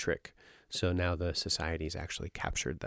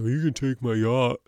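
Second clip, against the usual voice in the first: put-on drunk man offering yacht; 5 semitones higher, 7 dB louder than usual. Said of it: "he's like".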